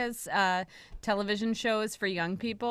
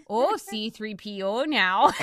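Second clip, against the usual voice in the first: slow mock Minnesotan with long round vowels; Minnesota accent. Imagining films shown in Minnesota, Minnesotan accent. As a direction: put on a playful Minnesota accent. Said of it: "every time you go to Minnesota".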